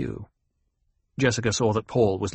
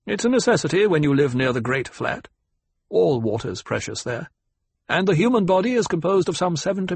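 pitched-up, emphatic lecturer; lecturing; 5 semitones higher than usual. Acted. Ecologist corrects the banker on Fremen custom, lecturing. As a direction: instruct them point by point, firmly. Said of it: "spoke in a lecturing tone".

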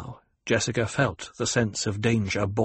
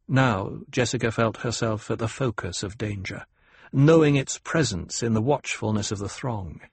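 ordinary delivery, nothing marked

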